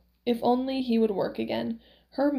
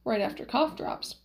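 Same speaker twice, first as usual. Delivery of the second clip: usual speaking voice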